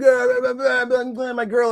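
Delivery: high, run-together blah-blah; mock ranting babble. Fan mimicking two wrestlers squabbling, mock ranting. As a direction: gabble it as a mocking imitation of an argument.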